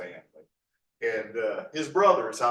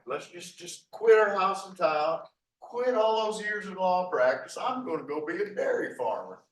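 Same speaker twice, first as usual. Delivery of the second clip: higher, louder acted-out declaration; mock rash announcement; 9 semitones higher, 5 dB louder than usual. Lawyer announces he's quitting town to farm, mock excited. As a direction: blurt it out as a mock big decision.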